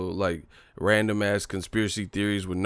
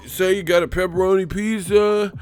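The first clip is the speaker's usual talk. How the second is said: much higher, louder put-on mocking voice; mocking commenter; 10 semitones higher, 5 dB louder than usual. Mocking fans' tainted-food theory, much higher put-on voice. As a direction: sneer it as a clueless fan. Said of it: "I'm sick of these".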